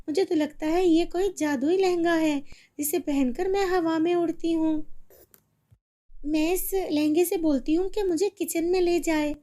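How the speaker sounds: put-on high child voice, thinking aloud; figuring out the magic; girl realizing the dress is magic; puzzled girl.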